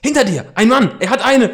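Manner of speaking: high, loud alarmed warning; alarmed cry. Acted. Justus warns Peter of the armed man, alarmed cry. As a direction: shout the warning in sudden fright.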